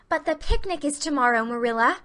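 put-on a young girl crying out in dismay; cried. A narrator's reading. Anne pleading with Marilla about the picnic, high and upset. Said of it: "cried Anne".

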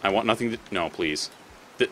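ordinary talk

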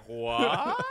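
loud, high incredulous "what"; stunned disbelief. Acted. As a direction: react as if you just heard nonsense, laughing. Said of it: "everyone being like".

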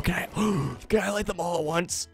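soft, eager plea; hushed pleading. Real, not acted. Player hoping to light all torches, hushed pleading. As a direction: beg under the breath.

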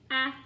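a as short "æ", as in apple; short vowel sound. Shown as a demonstration. Teacher drilling short vowels, a as "æ". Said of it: "A, apple".

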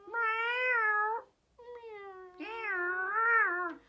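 loud, drawn-out pretend meow; imitated cat call. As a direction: yowl like a cat. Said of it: "a cat?".

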